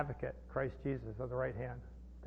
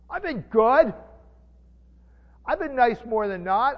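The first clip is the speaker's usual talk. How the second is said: a hint of a smug do-gooder, higher and louder; self-righteous churchgoer; 5 semitones higher, 6 dB louder than usual. Preacher imitating people who think God owes them, lightly smug. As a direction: brag lightly about being good enough. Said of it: "I've met people that believe that".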